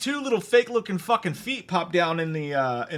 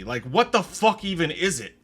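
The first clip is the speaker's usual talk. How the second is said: normal talking voice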